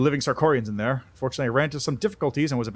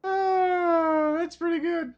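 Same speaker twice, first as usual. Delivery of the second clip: amused sigh; much slower than usual. Real, not acted.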